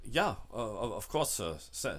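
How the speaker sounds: lightly voiced driver, soft and halting; quietly deferential, stumbling a little; mild, hushed servant-like assent; obliging driver. Game master answers as the driver.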